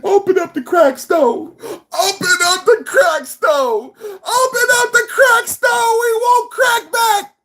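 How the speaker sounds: high wail climbing to a hammed-up shriek; mock crying, building to wailing. Host mimics haters begging for the crack store, escalating mock wail. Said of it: "That's how y'all sound".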